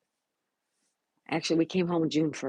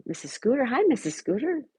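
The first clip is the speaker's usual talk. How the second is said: excited greeting; 8 semitones higher than usual. Real, not acted. Greeting a viewer who arrived.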